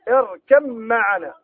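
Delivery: اركب معنا slowly with the ba merged into a doubled mim; slow Quranic recitation with assimilation. Shown as a demonstration. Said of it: "في نحو قوله تعالى".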